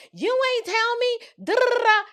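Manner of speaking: high-pitched, exaggerated whiny retort; mock protest. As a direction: protest high, trailing into blah-blah syllables. Acts out her own protest to friend.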